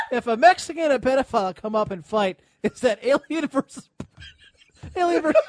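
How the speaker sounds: reading a joke while cracking up; can barely get the words out laughing. Real, not acted. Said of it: "Nip says".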